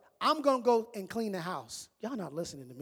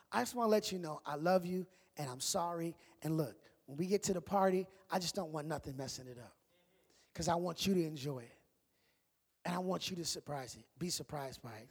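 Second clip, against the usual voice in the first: soft, low, intimate reassurance; hushed, sincere words to a loved one; tender apology; 6 semitones lower, 7 dB quieter, faster than usual. Acted. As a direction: lower your voice and gently make peace. Acting out a loving apology.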